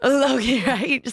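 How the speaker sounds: laughing it off, bright and up; amused laughing agreement. Real, not acted. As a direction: laugh through the words. Laughing at the flex joke.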